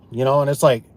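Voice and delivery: ordinary talk, nothing marked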